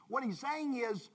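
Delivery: ordinary talk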